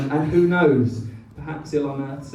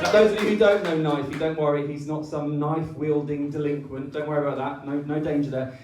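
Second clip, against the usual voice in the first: normal talking voice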